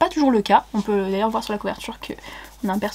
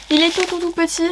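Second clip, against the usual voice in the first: delighted cooing; 8 semitones higher than usual. Real, not acted. Cooing over a tiny parcel.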